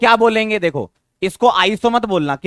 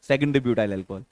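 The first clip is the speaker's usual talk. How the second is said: quick model answer; 7 semitones lower, 7 dB quieter, faster than usual. Shown as a demonstration. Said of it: "तो मैं ऐसे बोलूंगा".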